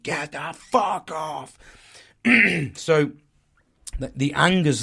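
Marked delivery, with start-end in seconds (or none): 0.0-1.6 slow, drawn-out angry growl, acted out; re-enacted angry tone. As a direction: act out a menacing outburst, stretching the words. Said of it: "feeling my tone in my voice".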